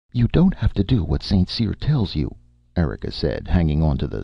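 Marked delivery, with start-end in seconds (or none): 0.1-2.4 urgent persuasion. A narrator's reading. Erika argues with struggling star.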